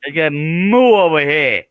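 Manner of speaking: put-on loud, slow moo in a New York accent; New York cow. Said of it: "Do your best New York cow".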